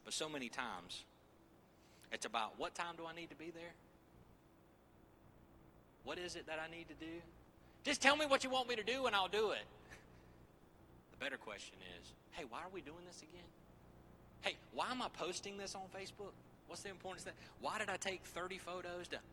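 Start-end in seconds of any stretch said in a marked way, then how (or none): 2.6-7.2 higher-pitched, acted-out questions; compliant questioning. Voices eager-to-comply churchgoer's questions. 7.8-9.6 loud, high-pitched mock exasperation; impatient outburst. Acts impatient person wanting orders. 12.3-19.2 higher, softer acted questions; puzzled self-questioning. Acts out people questioning their motives.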